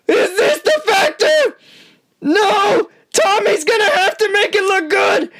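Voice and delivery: high, urgent real yells; excited shouting. Real, not acted. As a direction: call it out high, frantic for Tommy. Reacting live to the fight.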